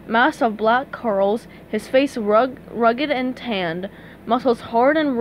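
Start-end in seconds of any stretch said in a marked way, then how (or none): none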